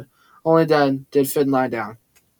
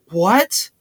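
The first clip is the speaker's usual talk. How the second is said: higher, sharply rising question; disbelief; 4 semitones higher than usual. A narrator's reading. Tyler accused of choking Max, asking in disbelief. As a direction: let it shoot up, incredulous. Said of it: "Tyler asked in disbelief".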